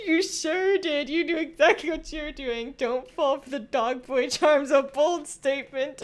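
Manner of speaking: loud, high talking through laughter; helpless laughter. Real, not acted. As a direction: cackle out the words, real amusement. Laughing at chat quoting them.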